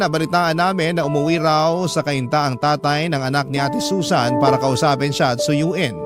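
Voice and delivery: ordinary talk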